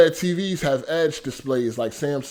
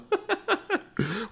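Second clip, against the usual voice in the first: high laugh; 5 dB quieter than usual. Real, not acted. Laughs about ads catch.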